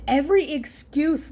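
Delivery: normal talking voice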